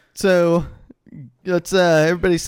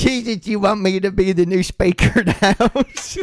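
mock Southern; 4 semitones higher than usual. Paul Ryan asking Jesus, mock Southern through laughter. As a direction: pray in a hammed-up Southern voice, laughing. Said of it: "I'm doing Southern".

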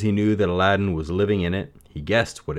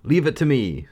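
put-on higher confident young man; Aladdin voice; 8 semitones higher, 4 dB louder than usual. A narrator's reading. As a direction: say it with easy confidence. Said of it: "said Aladdin".

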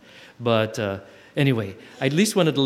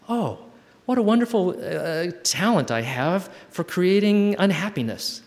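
mock joyful; 6 semitones higher than usual. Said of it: "Just notice with joy".

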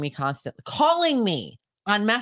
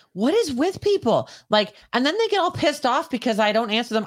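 exasperated rant; 6 semitones higher than usual. Real, not acted. Rant about stranger messages.

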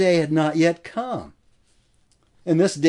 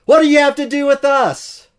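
much higher and louder, a shrieking outcry; demon's loud cry; 9 semitones higher, 8 dB louder than usual. Acted. Preacher acting the demon in the synagogue, shrieking. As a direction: scream it like a tormented spirit. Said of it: "cries out with a loud voice".

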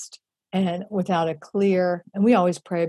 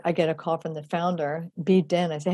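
ordinary talk, nothing marked

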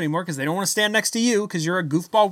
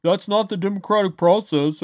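prissy mock pundit voice, a bit higher; slightly raised, mocking impression of a conservative commentator; mocking Tomi Lahren; 3 semitones higher than usual. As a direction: sneer it out as a smug TV pundit. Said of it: "comes out and says".

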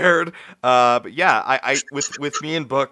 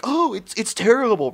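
higher-pitched mock disgust; mock dismissive; 11 semitones higher than usual. Retelling critics' verdict on a kids' show, mock dismissive. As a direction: imitate the naysayers, pitched up high. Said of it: "everybody said, like".

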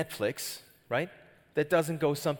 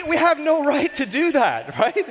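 laughing through the words, high and loud; 13 semitones higher, 9 dB louder than usual. Real, not acted. Pastor cracking up at his own accent joke, laughing. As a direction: laugh it out, pitched up.

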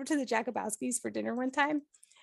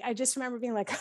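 ordinary talk, nothing marked

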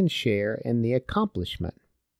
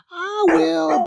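much higher, louder yapping dog voice, eager to eat; eager barking dog; 4 dB louder than usual. Acted. Greedy dog suddenly volunteering to eat the bread, high eager yap. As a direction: squeal it out like a greedy little dog. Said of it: "barked the dog".

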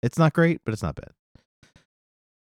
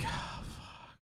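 hushed, breath-only curse; dreading whisper; 17 dB quieter than usual. Acted. Second guy facing his choice, dreading whisper.

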